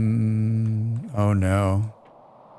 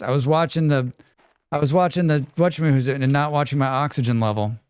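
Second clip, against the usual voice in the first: ordinary delivery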